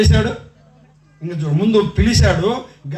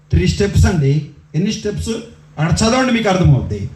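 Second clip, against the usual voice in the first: normal talking voice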